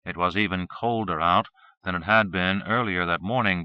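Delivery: usual speaking voice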